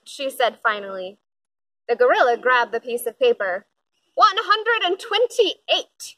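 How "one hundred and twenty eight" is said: slow, grinning announcement; announcing with a grin. A narrator's reading. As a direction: savor the number, pleased with himself. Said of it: "he announced with a grin".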